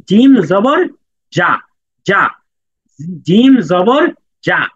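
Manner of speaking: জিম জবর জা drawn out slowly; slow model reading. Shown as a demonstration. Teacher leading students through জিম জবর জা slowly. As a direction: spell it out slowly, syllable by syllable. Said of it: "এইভাবে পড়তে হবে".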